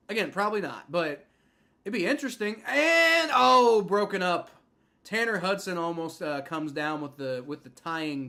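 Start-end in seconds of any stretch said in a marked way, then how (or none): 2.7-4.5 much higher and louder, calling the play as it breaks; live play-call outburst. Real, not acted. Fan reacting live as the pass is broken up, excited. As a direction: blurt the play as it happens. Two-point try fails live.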